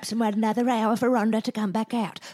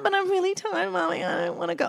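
put-on whiny child; 9 semitones higher than usual. Child pleading, laughing.

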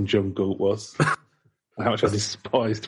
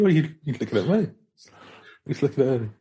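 mock stranger; 5 semitones higher, faster than usual. Mocking the lift stranger.

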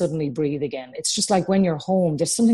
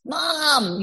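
put-on child butting in; 10 semitones higher than usual. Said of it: "when they're at home and they're running in and out".

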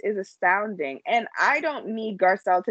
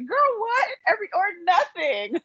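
put-on high laughing "girl, what"; squeaky nervous giggly question; imitating Sutton; 9 semitones higher than usual. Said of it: "it sounds like".

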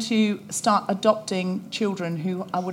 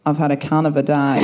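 broad Australian accent, lower; Australian accent, amused; 7 semitones lower than usual. Shown as a demonstration. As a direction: drop back into her native Australian twang. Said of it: "in my original Australian accent".

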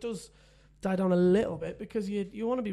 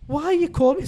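higher and louder, fed-up outburst; exasperated; 8 semitones higher, 8 dB louder than usual. Retold or imitated. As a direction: throw up your hands at the caller. Exasperated husband on phone.

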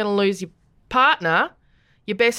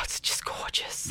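whispering; 11 dB quieter, slower than usual. Real, not acted. Dreamy whisper about New Zealand.

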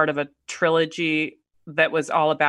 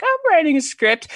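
mock timid announcement; 11 semitones higher, 5 dB louder than usual. Mock nervous self-announcement.

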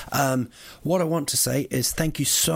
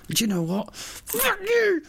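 mock outburst. Real, not acted. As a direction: let rip at him. Yelling at taxi driver.

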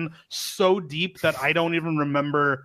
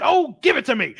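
higher, louder mock demand; eager demand; 6 semitones higher, 4 dB louder than usual. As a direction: act out a grabby, eager demand. Acting out Cox grabbing for soap.

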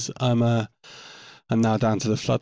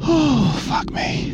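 startled gasp; 13 semitones higher, 5 dB louder, much slower than usual. Real, not acted. Sudden view through viewfinder.

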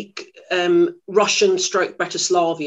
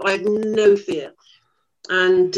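ordinary talk, nothing marked

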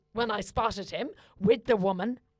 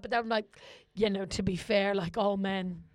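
ordinary talk

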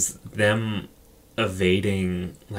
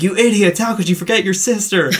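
high, loud scolding in imitation; mock indignant sister; 11 semitones higher, 6 dB louder than usual. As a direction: scold them loudly as the outraged sister. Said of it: "the sister's like".

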